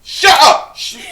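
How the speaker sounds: high loud yell; shout. Acted. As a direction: yell it to shut them up. Yelling at mocked caller.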